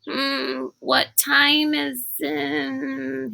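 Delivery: slow drowsy half-asleep question; groggy, just woken. A narrator's reading. Sticky woken before dawn by Rainey, slow and drowsy. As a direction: drag the words, still half asleep.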